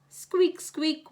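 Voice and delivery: high squeaking creak; squeaky lantern. A narrator's reading. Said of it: "went the lanterns".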